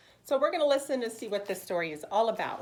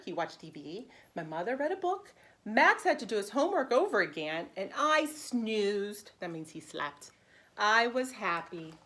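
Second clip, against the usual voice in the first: bright, lively read-aloud for preschoolers; animated picture-book narration; storybook reading; 7 dB louder than usual. Acted. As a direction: read it like story time for little kids. Teacher reads picture book aloud.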